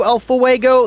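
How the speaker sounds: ordinary talk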